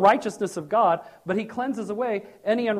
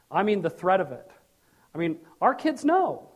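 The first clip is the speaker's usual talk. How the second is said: usual speaking voice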